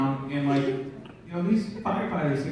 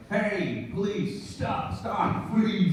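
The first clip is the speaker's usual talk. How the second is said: slow, measured mock police orders; commanding police voice; 3 semitones higher, 3 dB quieter, much slower than usual. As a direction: give orders like a cop demanding respect. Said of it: "the voice commands so much respect".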